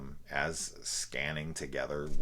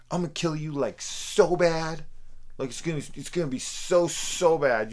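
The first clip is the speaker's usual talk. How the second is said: put-on higher, louder, whiny kid making a threat; mocking childish threat; 12 semitones higher, 9 dB louder than usual. Reviewer mocking the villain's bad line, whiny childish taunt. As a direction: sneer it like a ten-year-old's comeback. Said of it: "like a comeback from, like, a ten-year-old".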